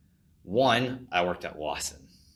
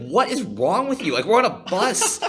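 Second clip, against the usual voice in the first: high, loud disbelieving outburst with a laugh; mock exasperation; 8 dB louder than usual. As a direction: act out being appalled, higher and louder. Her own retold outrage.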